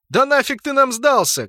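loud, high exasperated shout; shouted retort. A narrator's reading. Narrator shouts back at the fleeing orc, exasperated. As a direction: yell it back across a distance. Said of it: "крикнул я в ответ".

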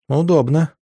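ordinary delivery, nothing marked

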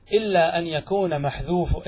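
ordinary delivery

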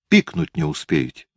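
put-on a defiant little boy speaking up; child voice. Boy tells aunt Liza, child voice.

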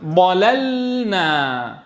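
ضللنا very slow, first lam held apart; clear first lam, slow. Shown as a demonstration. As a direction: separate the two lams of ضللنا clearly. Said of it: "أن تبين السكون في اللام الأولى".

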